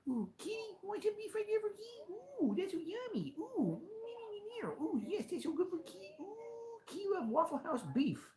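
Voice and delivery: very high, soft cooing pet voice; baby talk to cat. Acted. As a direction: coo at the kitty like a baby.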